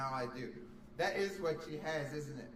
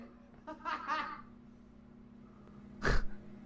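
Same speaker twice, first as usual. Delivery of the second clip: breathless high laughter; high wheezing laugh; 13 semitones higher than usual. Real, not acted. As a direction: laugh hard at the joke. Laughs at own story.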